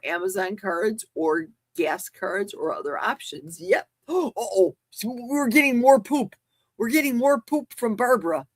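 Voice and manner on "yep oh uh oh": ordinary talk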